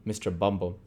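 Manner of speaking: usual speaking voice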